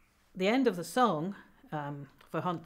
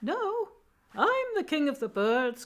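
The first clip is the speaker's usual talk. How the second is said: high, small bird voice; little wren voice; 7 semitones higher, 3 dB louder than usual. Acted. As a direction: pipe up in a tiny high-pitched voice to contradict. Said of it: "up popped a little voice saying".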